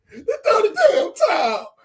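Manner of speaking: high laughing cry of throw the towel. Real, not acted. Host laughing at the Rocky scene, high and broken. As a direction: crack up mid-plea.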